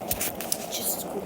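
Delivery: soft slow whisper. Real, not acted. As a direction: whisper it slowly. Whispered aside after odd name.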